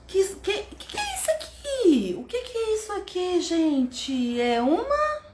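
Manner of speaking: high, playful quizzing voice; teacher to children. Shown as a demonstration. Teacher asking children what the picture is, high and playful. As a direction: ask with exaggerated wonder, pitched well up.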